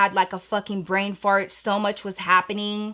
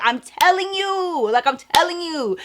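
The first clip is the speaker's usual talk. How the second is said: emphatic insisting; 7 semitones higher than usual. Retold or imitated. Telling her friend she's being cheated, emphatically insisting. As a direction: insist with exasperation, stretching the words.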